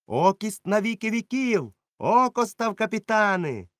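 high, loud cheer. Acted. Pirates cheering Silver as captain, high and loud.

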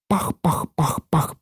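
soft imitated puffs; puffing engine. A narrator's reading. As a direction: puff it out like a steam engine. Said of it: "пахкала вона". (тихе пахкання машини)

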